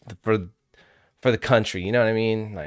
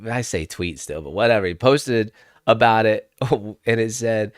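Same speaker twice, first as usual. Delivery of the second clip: normal talking voice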